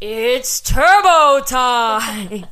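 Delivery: very slow, loud Arnold line; Schwarzenegger impression. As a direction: stretch it out like Arnold. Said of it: "here's some good quotes from the movie".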